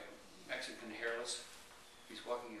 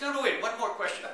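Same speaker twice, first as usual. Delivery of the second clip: urgent plea; 9 semitones higher, 13 dB louder than usual. Acted. Dog owner pleads with bartender.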